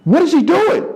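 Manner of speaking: high, baffled gasp of a question; can't believe what he's seeing; young comic watching in shock; stunned disbelief. Retold or imitated. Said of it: "I'm watching like".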